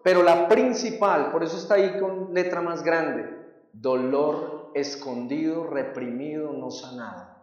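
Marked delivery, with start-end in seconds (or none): 3.7-7.3 slow, much softer and lower, dying away; fading hush. Acted. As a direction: let the pain sink into silence. Peso en la idea principal. (cada vez más suave, lento y grave)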